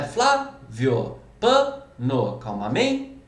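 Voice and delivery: low, split FLÁ-vio, PA-no, calmaMEN-te, stressed syllable held; sliding stressed syllable. Shown as a demonstration. Teacher likening stress to a slide, penultimate syllable drawn out. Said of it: "é quase como um escorregador".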